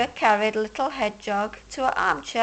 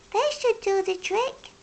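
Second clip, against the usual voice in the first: kind hedgehog; 9 semitones higher than usual. A narrator's reading. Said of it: "says Little Hedgehog".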